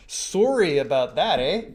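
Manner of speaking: hammed-up Canadian sorry, eh; Canadian accent. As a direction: play up the Canadian eh on the end. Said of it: "just did my best Canadian, impression".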